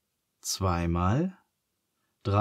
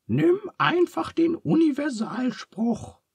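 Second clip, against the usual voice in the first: Charles's much higher voice calling out. A narrator's reading. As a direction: call it across the room. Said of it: "ruft Charles". (Charles ruft Phineas hoch zu)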